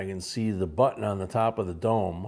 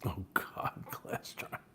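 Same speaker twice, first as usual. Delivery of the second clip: whispering; 3 semitones lower, 12 dB quieter, slower than usual. Real, not acted. Private reaction off to the side.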